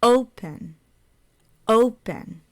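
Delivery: "Open" with punched O, softened pen; loud then soft. Shown as a demonstration. Teacher repeating for learners, "Open" stressed firmly on the O. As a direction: hit the first syllable of "Open" hard. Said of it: "So it's loud".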